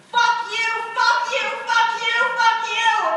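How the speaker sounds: repeated screaming curse; furious shout. Retold or imitated. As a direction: rage at God, all out. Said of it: "the loudest was behind me".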